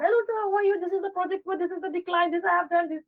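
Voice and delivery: rattling on without a pause; rushed run-on call. Shown as a demonstration. Rushing through a client call without pausing. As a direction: pile it all on at once, high and fast. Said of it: "No, don't start".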